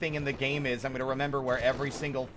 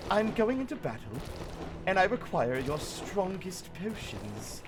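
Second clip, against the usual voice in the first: pompous knight; 3 dB quieter than usual. Pretend. Potion seller meme, knight voice.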